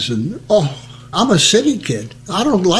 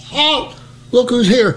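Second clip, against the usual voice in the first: wrong guard challenge; 8 semitones higher than usual. Retold or imitated. Private repeats his botched challenge to the sergeant, high mock shout. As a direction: replay the blunder proudly. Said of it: "I says".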